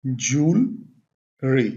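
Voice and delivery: two separate syllables for ज्वेलरी; correct pronunciation. Shown as a demonstration. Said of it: "इसकी pronunciation क्या होगी?". (ज्वेलरी का सही उच्चारण, "जूल... री")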